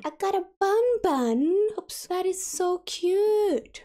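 delighted squeal at the cute bunny; gushing over cute. Real, not acted. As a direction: coo like you've found something adorable. Unlocks a cute bunny decoration.

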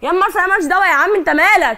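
angry yell. Real, not acted. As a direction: yell at him to back off. Wife yelling at husband in fight. (زعيق غاضب عالي)

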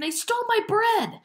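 very high-pitched outcry; screaming. A narrator's reading. Ellen reports her stolen bread, screaming. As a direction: scream the accusation. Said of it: "she screamed".

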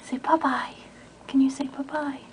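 soft whisper to a pet. Real, not acted. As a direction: whisper it gently.

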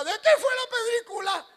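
high suspicious mother-in-law. Retold or imitated. Preacher mimicking his mother-in-law questioning the couple. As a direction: squeak it like a nosy mother-in-law. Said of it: "Y mi suegra decía".

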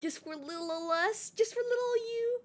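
high, cooing little voice; cutesy baby talk. Pretend. Streamer babying the chat, cutesy high cooing. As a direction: coo it as if to a baby.

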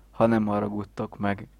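ordinary talk

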